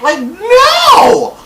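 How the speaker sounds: high, loud scolding; exasperated shout. Real, not acted. Him yelling at the daughter on screen, high and loud. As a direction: yell at the screen in frustration. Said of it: "scolding the TV character".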